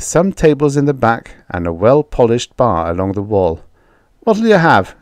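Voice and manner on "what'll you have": barkeep voice. Acted. Barkeep asks order.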